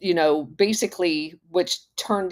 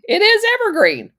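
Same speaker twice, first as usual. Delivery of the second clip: much higher, louder exclamation; excited; 7 dB louder than usual. Real, not acted. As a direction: burst out with the discovery. Confirms link to former employer.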